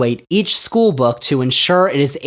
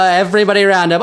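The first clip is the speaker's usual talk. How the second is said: ordinary talk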